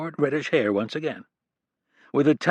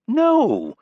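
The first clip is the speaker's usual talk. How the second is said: high, alarmed no; a sudden worried denial; startled, pitched-up no; troubled; 13 semitones higher, 5 dB louder than usual. A narrator's reading. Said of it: "he said, troubled".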